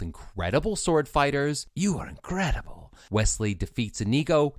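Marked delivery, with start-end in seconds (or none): 1.7-2.9 admiring swordsman. Retold or imitated. Inigo praising rival swordsman.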